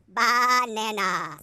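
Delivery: slow drawn-out sheep voice; silly sheep voice. Pretend. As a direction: stretch it out like a daft sheep. Silly sheep line in song.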